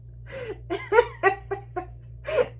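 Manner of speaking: high, helpless squealing laugh; high squeal of laughter. Real, not acted. Narrator loses it at the scene, squealing laugh. Cracking up at the story.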